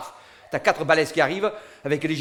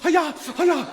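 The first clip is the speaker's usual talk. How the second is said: cris aigus comiques; 9 semitones higher, much slower than usual. Acted. Cris de la voiture, rires.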